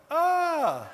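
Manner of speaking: high, loud mock shriek of fright; startled yelp. Patient shrieks when coach drops beside him. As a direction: jump at a sudden face beside you.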